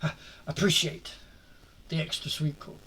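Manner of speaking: ordinary delivery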